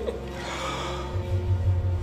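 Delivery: soft sinister chuckle; evil laugh. Acted. Hidden tormentor laughing at his victim, soft evil chuckle. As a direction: laugh low and mocking from the shadows.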